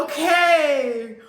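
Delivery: high, loud falling "okay"; loud exhaled okay. Real, not acted. Host recovering from laughing fit, loud okay. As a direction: call it out to pull yourself together.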